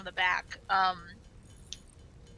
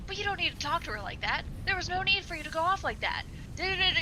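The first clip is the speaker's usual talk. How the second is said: much higher, heated scolding that trails into da da da; imitated yelling; 8 semitones higher, faster than usual. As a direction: rant at the customer in mock fury. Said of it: "He was just yelling".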